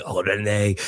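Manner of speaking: low, mock French sitcom line; a hint of French farce lovestruck cooing; lightly voiced 'Allo 'Allo character. Host imagines the actor in 'Allo 'Allo, lightly imitating. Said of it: "touring version of 'Allo 'Allo".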